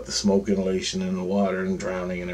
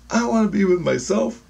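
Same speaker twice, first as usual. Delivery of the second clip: high put-on voice of a soul; voice of innocence; 12 semitones higher than usual. Spirit choosing who to be with, high imitated voice. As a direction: speak as your own spirit, pitched high. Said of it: "my innocence goes".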